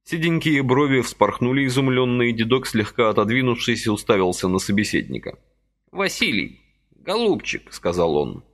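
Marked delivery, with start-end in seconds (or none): 5.9-7.7 put-on high fussy old man's voice, gently reproaching; a little old man chiding kindly; old man reproaching Vasily for his haste, high and fussy; reproachful old man. A narrator's reading.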